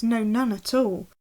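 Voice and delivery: usual speaking voice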